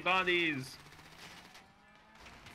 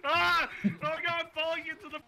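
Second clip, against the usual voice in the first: screaming while falling; 10 semitones higher than usual. Real, not acted. Character falling in game.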